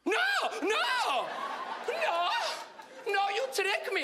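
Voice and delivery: very high drawn-out cries of protest; high-pitched, hammed-up outrage; outraged mother. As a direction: wail it as a tricked immigrant mother. Mother realizes son tricked her.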